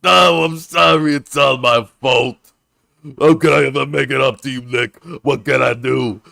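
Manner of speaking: grovel at Nick's feet, overplaying it; groveling apology. Voicing a guilty guard begging Nick, loud and hammed-up.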